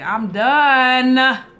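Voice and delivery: loud laughing exasperation; exasperated outburst. Real, not acted. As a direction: throw up your hands, done.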